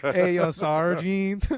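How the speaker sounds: drawn-out joking GI voice; American soldier impression. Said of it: "I'm doing an American soldier right now".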